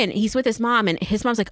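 ordinary talk

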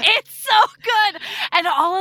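loud, high and thrilled; excited gushing. Real, not acted. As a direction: gush about a favourite book. Gushing over a beloved book.

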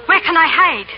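high, loud, frightened cry for cover; desperate to hide before they enter; panicked plea. Acted. Lady Blakeney begging the innkeeper for a hiding place, panicked. Soldiers arriving, she must hide.

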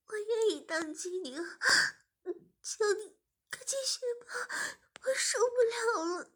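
high, crying plea; desperate tearful whimper. Acted. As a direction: beg through tears in a high voice. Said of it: "志敏流着泪说".

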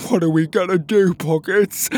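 high, loud character voice in distress; panicked cry. Acted. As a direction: wail it to your friend for help. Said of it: "Petey cried".

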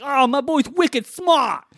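put-on drawn-out, high Boston accent caricature; mock Boston accent. Quoting the movie's dumb lines, hammed-up Boston accent. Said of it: "weird voice of having a Boston accent".

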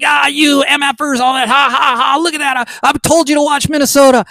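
high, cackling mock gloat with laughs; gloating TikTok leftist. Mocking a TikTok leftist crowing about Minnesota polls, hammed-up gloat. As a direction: lord it over everyone, mockingly. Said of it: "He was like".

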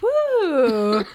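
put-on loud high drunken woo; party whoop. Tipsy guest arriving at boss's party, loud whoop. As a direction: whoop like you're tipsy and thrilled.

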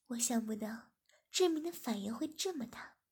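usual speaking voice

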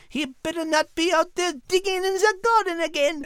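high French lady searching for her dog; Liz fussing. Acted. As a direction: call out then grumble. Liz voiced in home scene.

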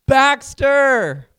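big, high, drawn-out shout; father calling out. Retold or imitated. Father calls to his lost son in the dark, shouting. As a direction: holler the name across a long distance.

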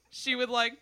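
ordinary delivery

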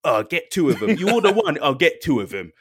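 lightly voiced English drinker pressing rounds; English pub mate. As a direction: play the English mate pushing another round. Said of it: "They're like".

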